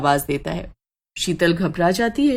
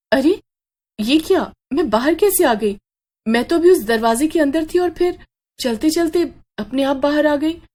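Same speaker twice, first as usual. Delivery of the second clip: bewildered surprise; 8 semitones higher than usual. Acted. Sheetal suddenly finds herself outside.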